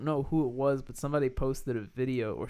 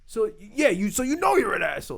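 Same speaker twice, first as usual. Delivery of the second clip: raised-pitch imitation of a challenging host; mock confrontation; 7 semitones higher, 4 dB louder than usual. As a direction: press the accusation, acted out. Acting out Taffer confronting the owner.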